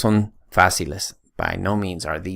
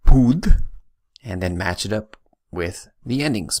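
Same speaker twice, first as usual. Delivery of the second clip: ordinary talk, nothing marked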